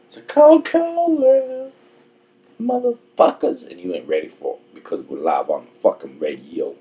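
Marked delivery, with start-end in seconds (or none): 0.1-1.7 cold, cold world squeaked very high and loud; hammed-up falsetto for the line; high mock voice. Host joking to his co-host in a high mock voice. 2.6-3.7 high mock voice. Host hamming a line.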